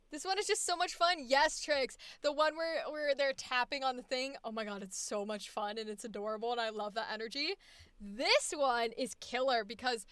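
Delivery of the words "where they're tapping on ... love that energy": ordinary talk, nothing marked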